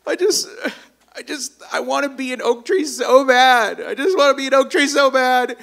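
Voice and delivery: high, hammed-up tearful acorn; exaggerated whiny blubbering, high-pitched; mock crying. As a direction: wail it out like a sobbing little acorn. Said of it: "hears the little acorn crying".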